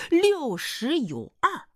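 六十有二 drawn out slowly, pitched high; slow emphatic repeat. Acted. Suitor repeats the boss's age, slow and emphatic. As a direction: spell out 六十有二 grandly, word by word.

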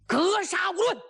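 a ruthless battlefield command; shouted order. Acted. Commander threatens his troops, loud shouted order.

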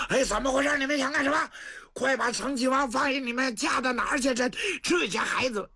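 put-on high-pitched alarmed shout at the fleeing kids; shouting after them. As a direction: yell after them in a panic. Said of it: "程咬金在后头直喊". (高声急喊)

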